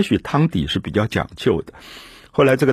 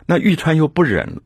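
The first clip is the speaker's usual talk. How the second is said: ordinary talk, nothing marked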